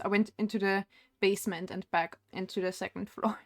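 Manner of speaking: ordinary delivery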